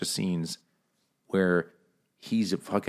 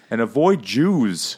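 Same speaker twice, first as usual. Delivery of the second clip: mock old doctor; 4 semitones higher, 6 dB louder, slower than usual. Mocking doctor's old-time advice.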